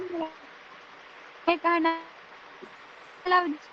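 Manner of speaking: breaking up on a bad connection; call dropping out. Real, not acted. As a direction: voice cutting out over a bad call line. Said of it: "आपकी आवाज cut रहा है".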